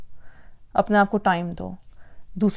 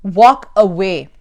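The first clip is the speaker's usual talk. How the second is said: "वॉक away" said slowly and louder, "walk" stressed; slow firm advice; 3 semitones lower, 6 dB louder, much slower than usual. Shown as a demonstration. To stressed parents, slow firm advice. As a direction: spell it out like a firm instruction. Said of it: "फिर क्या करो?".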